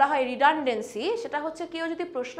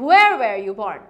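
"Where" hit loud, the rest lighter; stress on where; 5 semitones lower, 9 dB louder, much slower than usual. Shown as a demonstration. Teacher showing learners where to push the stress. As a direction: punch "Where" louder, then ease off. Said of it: "একটু জোরে, where".

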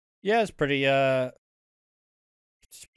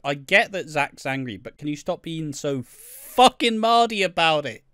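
higher and louder exasperated telling-off; mock angry rant; 7 semitones higher, 6 dB louder than usual. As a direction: scold him to grow up. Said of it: "I'm like".

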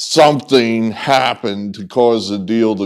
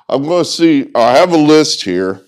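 normal talking voice

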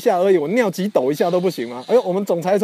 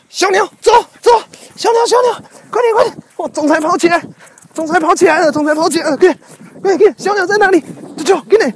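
much higher, eager calls to the dog; excited pet urging; 11 semitones higher than usual. Real, not acted. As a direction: egg the dog on to chase the birds. Urging dog to chase birds.

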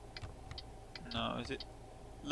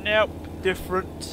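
mock game voice; 6 semitones higher, 4 dB louder than usual. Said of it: "it just snapped and went".

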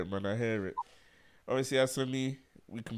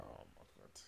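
whispered aside; 12 semitones lower, 23 dB quieter than usual. Retold or imitated. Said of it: "I'm like".

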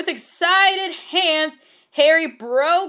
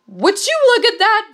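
character line; 8 semitones higher, 5 dB louder than usual. Acted. Reading fanfic character quote aloud.

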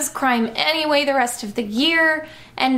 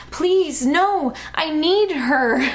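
higher, hammed-up begging mother; pleading protest; 4 semitones higher, slower than usual. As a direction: beg in a raised voice. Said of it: "my mother protested".

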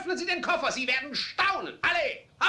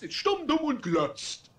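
slow, sneering jeer; scornful mockery; slower than usual. Father sneering at his silent wife, slow mockery. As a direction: spit each insult out slowly.

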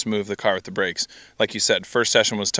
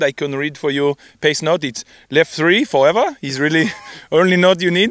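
put-on French accent; 6 semitones higher than usual. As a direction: read pace notes like a French co-driver. Co-driver joking in French accent.

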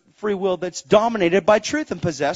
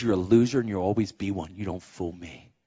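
low, quiet mock put-down; imitated contempt; 14 semitones lower, 9 dB quieter than usual. Stepdad to the groom at the wedding, contemptuous.

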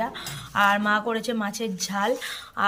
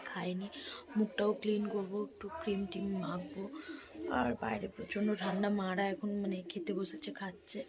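hushed voice; 2 semitones lower, 12 dB quieter than usual. Real, not acted. Vlogger to viewers as Soham sleeps, hushed. Child sleeping under mosquito net nearby.

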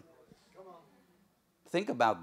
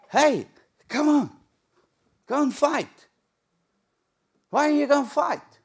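much higher and louder mocking call; mocking brother; 12 semitones higher, 8 dB louder than usual. Older brothers mocking young David, high loud jeering. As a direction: jeer at the little brother.

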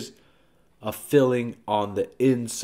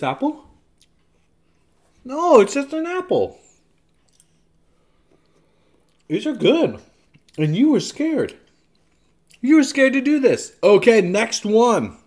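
excited teasing; 11 semitones higher, 6 dB louder than usual. Real, not acted.

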